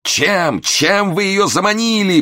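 high, slow wail; anguished moan. A narrator's reading. Momus moans at the clerk, high and slow. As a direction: stretch it out in mock despair. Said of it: "простонал Момус".

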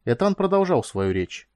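usual speaking voice

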